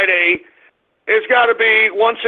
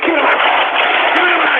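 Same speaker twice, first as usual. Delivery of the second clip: furious, raised-pitch demand; enraged yell; 4 semitones higher, much faster than usual. Real, not acted. Host demanding the mic from his crew, enraged yell. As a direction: explode into a distorted roar.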